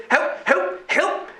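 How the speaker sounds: very high, strained begging voice; desperate plea. Acted. Floating body begs the crew for rescue, desperate plea. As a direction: beg in short, high repeated cries.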